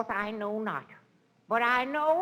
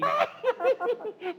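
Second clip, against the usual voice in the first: high cackling laugh; 11 semitones higher than usual. Acted. Drunken laughter.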